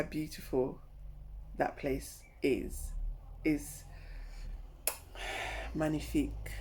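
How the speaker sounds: soft, slow, low wonder; hushed awe. Real, not acted. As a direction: breathe it out in awe. Awed at the view.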